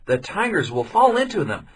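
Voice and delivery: put-on young man explaining the trap to villagers; young man's voice. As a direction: lay out the plan clearly. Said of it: "said the young man".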